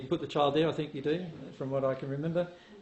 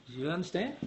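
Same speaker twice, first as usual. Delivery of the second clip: normal talking voice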